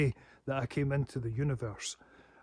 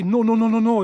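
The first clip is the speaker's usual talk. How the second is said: a quick, loud string of refusals, higher; 6 semitones higher, 10 dB louder than usual. Pretend. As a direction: bat away the pop-ups out loud. Refusing cookie consent pop-ups.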